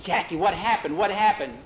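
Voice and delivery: urgent question. Acted. Boy rushes up asking urgently.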